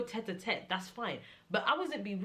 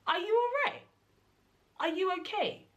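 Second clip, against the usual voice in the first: much higher and louder, incredulous; mock outraged yell at the rude vendor; outraged retort; 13 semitones higher, 6 dB louder than usual. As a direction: hit it in shrill disbelief.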